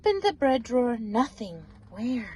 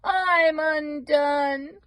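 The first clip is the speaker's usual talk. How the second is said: higher, louder crying that slows to a wail; sobbing wail; 6 semitones higher, 6 dB louder, much slower than usual. Acted. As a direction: give up in tears, very slowly at the end. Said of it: "he blubbered".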